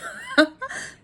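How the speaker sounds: high-pitched real laugh. Real, not acted. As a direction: laugh at your own impression. Laughs at own impression.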